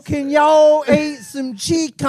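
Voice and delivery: slow, mangled Texas drawl; hammed-up Texas twang gone wrong; mock Texas-English accent. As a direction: drag out a badly faked Texas accent. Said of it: "of a Texas English accent".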